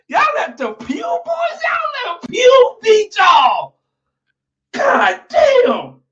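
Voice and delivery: high wailing stretched-out yell; hammed-up disbelieving shout; mock outrage. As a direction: wail it like you can't believe it. Mock-outraged roast of rival team.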